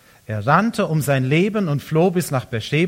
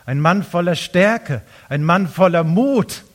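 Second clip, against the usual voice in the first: ordinary talk, nothing marked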